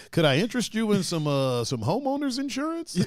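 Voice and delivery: high, sing-song pushy salesman. Retold or imitated. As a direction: pitch it like a cheesy telemarketer. Imitating scammer salesman.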